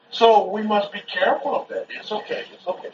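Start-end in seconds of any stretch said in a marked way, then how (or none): 1.9-2.9 very soft, hushed reassurance. Real, not acted. Father to his son who fell, hushed and soothing. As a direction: comfort a little one under your breath.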